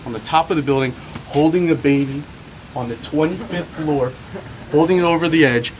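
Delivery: ordinary talk, nothing marked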